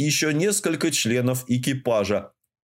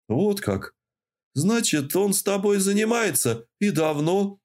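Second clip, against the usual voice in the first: нарочито равнодушно; 8 semitones higher than usual. A narrator's reading. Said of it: "как можно равнодушнее спросил".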